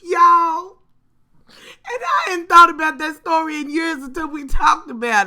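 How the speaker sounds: crying with laughter while talking; laughing hard. Real, not acted. Retelling the story to viewers, crying with laughter. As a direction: squeal through the laughter.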